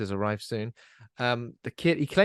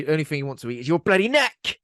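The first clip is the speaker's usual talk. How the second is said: louder rant; 4 semitones higher, 6 dB louder than usual. Pretend. Mocking the angry man.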